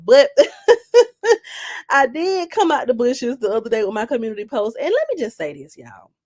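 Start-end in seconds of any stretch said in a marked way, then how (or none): 0.3-1.8 loud high cackle; big loud laugh. Real, not acted. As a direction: burst out laughing hard.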